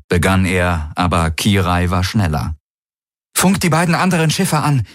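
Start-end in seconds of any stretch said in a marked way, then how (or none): 3.3-4.8 high, firm order to the ship; commanding order. Acted. Kirai orders the Vago to hail the other ships, firm and raised. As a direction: snap out a command to the ship's AI. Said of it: "befahl sie der Vago".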